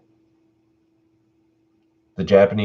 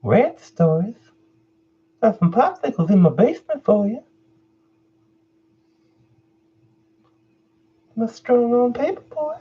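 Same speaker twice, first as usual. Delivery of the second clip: high, fast creepy stranger voice; creepy man voice. Acted. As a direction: lure them in like a creep. Creepy character voice on stream.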